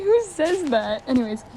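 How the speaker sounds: ordinary talk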